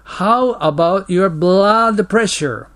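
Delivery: slow, careful English read for learners; slow model reading. Shown as a demonstration. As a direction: read each word out slowly and clearly. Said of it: "English model dialogue read slowly". (ゆっくり丁寧な英語の模範読み)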